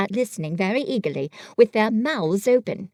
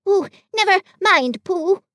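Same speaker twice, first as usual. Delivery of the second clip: higher, brisk Piglet brushing it off; impatient; 9 semitones higher, 5 dB louder than usual. Acted. Piglet brushing off Pooh, a touch impatient. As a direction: wave it away, impatient. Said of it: "said Piglet impatiently".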